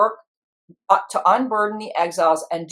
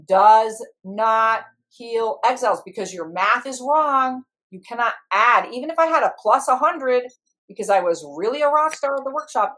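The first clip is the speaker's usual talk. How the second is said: ordinary delivery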